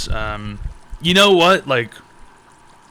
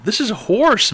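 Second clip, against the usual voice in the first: mock outrage; 8 semitones higher, slower than usual. Ranting about the holiday.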